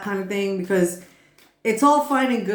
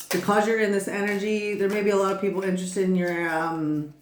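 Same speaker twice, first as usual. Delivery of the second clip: ordinary talk, nothing marked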